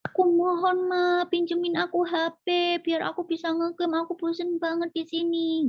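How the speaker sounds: put-on high pleading child's whine; child whining to mom for the phone. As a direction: beg mom for the phone like a whiny kid. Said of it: "anak merengek".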